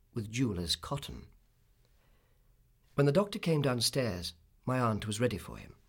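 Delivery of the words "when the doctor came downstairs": ordinary delivery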